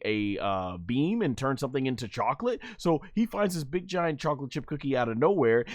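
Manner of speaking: normal talking voice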